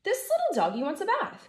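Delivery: put-on high, excited little girl voice; little girl crying out. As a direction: squeal it like a delighted little girl. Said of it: "cried the little girl".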